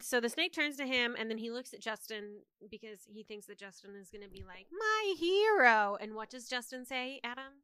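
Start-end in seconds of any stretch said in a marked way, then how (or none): 4.7-6.0 mock swoon. Justin voiced swooning.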